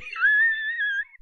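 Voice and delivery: very high shrieking cackle; shrieking laugh. Acted. Streamer mocking phone-addicted kids, very high shrieking laugh.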